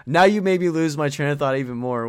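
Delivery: half-laughing indignant outburst; exasperated. Real, not acted. Friend derails him, he protests.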